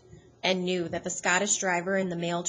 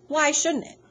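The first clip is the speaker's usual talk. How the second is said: higher, louder defensive snap; angry retort; 8 semitones higher, 6 dB louder than usual. A narrator's reading. Charles answering a doubting onlooker, angry higher snap. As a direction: bristle at the doubt in his load. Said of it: "Charles said angrily".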